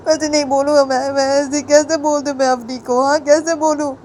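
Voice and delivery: high, plaintive student voice; distressed, near tears. A narrator's reading. Student frets to his teacher that Papa will not spare him, high and tearful. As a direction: plead helplessly in a high whine, building toward tears. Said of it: "उसे रोने लग जाता है".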